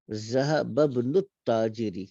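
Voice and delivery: ذہب ابن التاجری read slowly with the alif of ابن silent and the words joined; slow joined Arabic reading. Shown as a demonstration. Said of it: "الف کو silent کریں گے اور یہ با کے ساتھ ملائیں گے". (ذہب ابن التاجری آہستہ، ملا کر پڑھیں)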